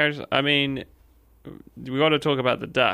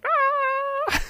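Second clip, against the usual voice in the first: high, drawn-out cartoon scream; imitated scream. Co-host imitating Courage waking from nightmare, high scream. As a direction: mimic a scream at a far higher pitch.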